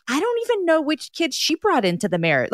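high-pitched puzzled self-quote; mock bewilderment. Follower looking at family photos, acting out high-pitched bewilderment. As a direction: pitch it well up in playful confusion. Acts out own puzzled reaction.

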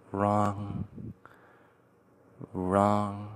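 soft, distant echo of "wrong"; echoing whisper. A narrator's reading. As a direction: let "wrong" fade away like an echo. Echoing repeated word.

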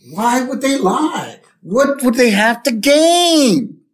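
put-on high, slow pleading kid voice; imitated earnest kid asking why; Milhouse. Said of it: "So Milhouse goes".

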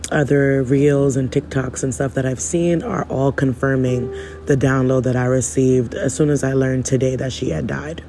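ordinary talk, nothing marked

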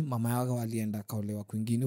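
ordinary delivery

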